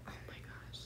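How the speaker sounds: breathless whisper of shock. Retold or imitated. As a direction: whisper it as if you can't believe your eyes. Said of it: "his face was like".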